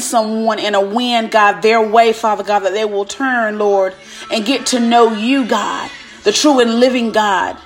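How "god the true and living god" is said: ordinary delivery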